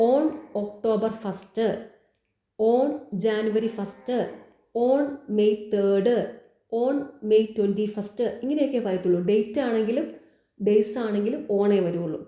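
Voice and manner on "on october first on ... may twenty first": slow example reading. Shown as a demonstration. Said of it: "slowly reads out examples".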